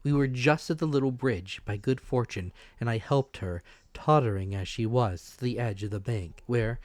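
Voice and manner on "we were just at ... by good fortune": usual speaking voice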